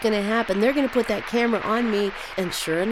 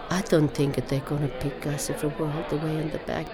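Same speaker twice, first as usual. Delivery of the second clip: lower, dejected Italian-English imitation; bereft Italian-accented mother; 4 semitones lower, 5 dB quieter than usual. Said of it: "My mother was just bereft".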